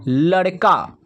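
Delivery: normal talking voice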